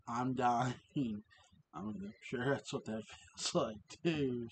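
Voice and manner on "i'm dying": plain reading voice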